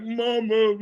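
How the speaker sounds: high, loud whimper for mom, hammed up; mock weepy. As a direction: imitate yourself crying to your mother. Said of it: "I call sometimes all weepy".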